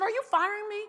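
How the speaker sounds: startled, acting out sudden realization; alarmed question. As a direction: pitch it up in mock alarm. Retold shock at being fired.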